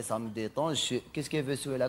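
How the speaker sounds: ordinary talk, nothing marked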